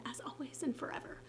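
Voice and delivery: hushed sign-off sinking into a whisper. Real, not acted. Vlogger signing off to her viewers for no reason, whispering. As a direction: drop to a soft, playful whisper. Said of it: "I don't know why I'm whispering".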